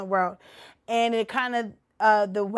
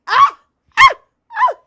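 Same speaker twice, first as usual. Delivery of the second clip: high screams of anguish; shrieking in despair; scream of dismay; 12 dB louder than usual. Real, not acted. Torn over which movie to kill, anguished screams.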